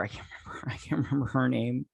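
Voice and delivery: very soft whisper under the breath; whispered aside. Real, not acted. Vlogger forgetting the character's name, whispered. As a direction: mutter it as a whisper.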